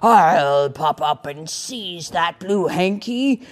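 slow, loud menacing troll. Acted. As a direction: growl it out like a lurking troll. Troll voiced in story.

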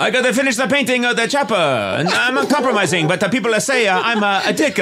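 high, laughing, hammy fake Italian; bad Michelangelo impression. Michelangelo defends himself at Chick-fil-A in a hammy Italian accent. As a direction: play Michelangelo with a silly high Italian voice, chuckling. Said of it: "the worst Michelangelo impression".

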